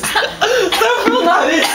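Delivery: laughing singsong. Real, not acted. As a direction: drag it out, laughing, way up high. Laughing at friend spitting drink.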